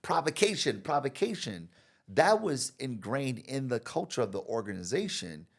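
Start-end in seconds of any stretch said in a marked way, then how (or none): 0.0-1.7 higher, louder insistent preaching; insistent client. Retold or imitated. Client insisting debate is good, loud high insistent. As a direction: sell the value of debate. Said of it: "he's like".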